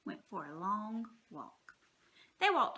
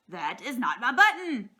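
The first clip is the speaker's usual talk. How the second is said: louder protesting cry; Toad's insistent complaint; cried out; 8 dB louder than usual. A narrator's reading. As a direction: push it out in frustration. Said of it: "cried Toad".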